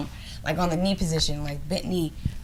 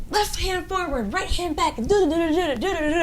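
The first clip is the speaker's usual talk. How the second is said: mimicking the instructor; 10 semitones higher than usual. Instructor voiced demonstrating karate moves.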